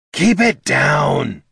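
high, loud, drawn-out complaint; irritated kobold voice. A narrator's reading. Kobold beside a waking dwarf, high irritated scolding. Sudden voice wakes startled Gorm.

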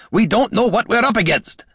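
high, agitated bark; high-pitched angry outburst. A narrator's reading. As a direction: snap in alarmed anger. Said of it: "barked Gorm".

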